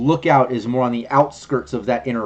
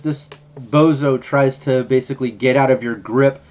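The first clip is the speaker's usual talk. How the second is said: ordinary talk, nothing marked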